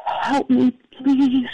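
high, strained plea of a dying man; imitating a dying neighbor gasping for help. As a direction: beg weakly, struggling to get the words out. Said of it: "he's struggling to speak".

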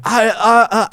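high, stammering, lost for words; stunned stammer. Retold or imitated. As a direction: freeze up mid-sentence. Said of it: "And I'm like".

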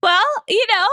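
ordinary talk

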